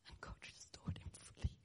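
hushed and barely voiced; whispered, overcome. Real, not acted. Pastor recalling boy healed instead of son, whispered. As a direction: let it almost disappear in a whisper. Emotional memory, breaking.

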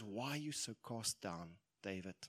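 soft, low question to oneself; quiet self-questioning. Acted. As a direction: murmur it to your own reflection. David gently questions his reflection.

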